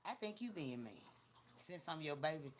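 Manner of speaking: ordinary talk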